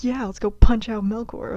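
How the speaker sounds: slow, pumped-up battle yell; gung-ho shout. Acted. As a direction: bellow it like a brawler ready to go. Eager warrior god wants fight.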